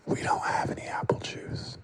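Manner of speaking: shout-whisper, pushed hard on don't; a harsh stage whisper in his face; whispered shout, stress on don't; forceful whisper. Retold or imitated. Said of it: "I whispered at him right there in his face".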